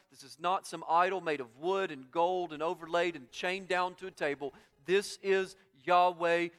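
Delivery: loud, driving preaching in rolling phrases; full-force preaching. Acted. Preacher declaring the only God to the congregation, full-force preaching. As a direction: build the list to a ringing declaration.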